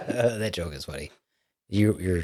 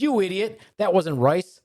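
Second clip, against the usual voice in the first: higher-pitched, exasperated correction; scolding madam; 7 semitones higher than usual. Acted. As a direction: put the fool straight in a raised pitch. Madam scolds customer in the joke.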